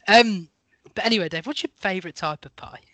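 ordinary talk, nothing marked

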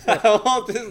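laughing nervously through words; nervous laughter. Real, not acted. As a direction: giggle nervously while talking. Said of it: "nervously laughing".

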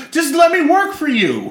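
high pleading shout; desperate shout. Retold or imitated. Desperate plea to employer.

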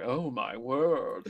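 drawn-out mock exclamation; imitated astonishment. Rory reacts to crawling at the audition, drawn-out imitation. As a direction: stretch each word very slowly. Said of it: "and Rory's like".

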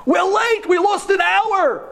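high, loud impatient yelling; teen friends shouting for him to hurry up; friends calling out. Retold or imitated. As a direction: call him over from across the street. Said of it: "I hear my friends".

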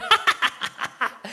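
high stifled giggling burst; acted laughter. Father and son laughing at their secret, acted laughter. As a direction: act out conspirators cracking up. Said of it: "we were laughing".

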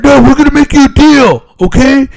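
hammed-up high squeaky car dealer; salesman. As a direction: squeal out the deal like a desperate dealer. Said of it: "the guy comes like".

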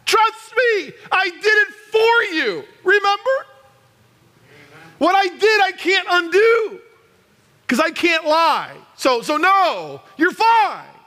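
high, impassioned preacher's shout; loud emphatic shout. Acted. Holy Spirit refusing to condemn a believer, emphatic shout. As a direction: drive each no harder, insist at full voice. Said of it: "I don't get that loud".

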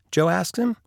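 usual speaking voice

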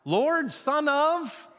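high, slow call, left hanging for the crowd to finish; high drawn-out shout; shouted prompt. Shown as a demonstration. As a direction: call it out and wait for them to answer. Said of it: "And what do they shout?".

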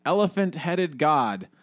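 a touch of a shout read from the book; mild read shout. A narrator's reading. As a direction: call it into the dark, slowly. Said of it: "shouted into the darkness".

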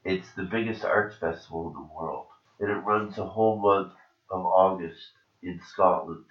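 ordinary talk

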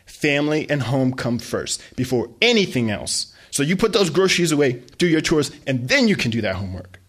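loud commanding lecture; mother lecturing her son on chores; stern mom. Acted. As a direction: drill the rules in.

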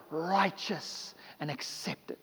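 soft, slow and tender; gentle pardon. Acted. Blood of Jesus speaking pardon over the sinner, soft and slow. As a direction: lay it down gently like a blessing.

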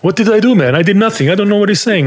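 high, pleading, innocent protest; Salah. Retold or imitated. Teammate protesting his innocence to the narrator, high and whiny. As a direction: squeak it out like a wronged teammate swearing he did nothing.

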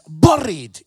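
buried shouted with a Scottish rolled r; loud Scottish buried, r rolled; Scottish accent. Shown as a demonstration. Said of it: "That's how it is".

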